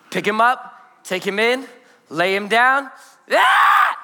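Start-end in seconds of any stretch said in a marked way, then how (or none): 3.3-4.0 very high, loud infant scream; sudden piercing mock baby cry; imitated baby wail. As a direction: shriek like a baby waking up. Imitates baby crying when laid down.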